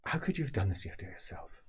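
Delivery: hushed, whispered disappointment; disappointed nephew whispering; reproachful whisper. Acted. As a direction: whisper it in dismay. Nephew reproaches his aunt.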